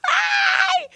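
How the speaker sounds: extremely high, stretched squeal; manic serial-killer greeting. Retold or imitated. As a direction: squeal a hello like a grinning maniac. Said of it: "waving and smiling like a fucking ser".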